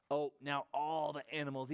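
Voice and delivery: usual speaking voice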